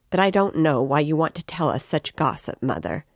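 plain reading voice

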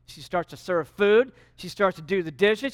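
normal talking voice

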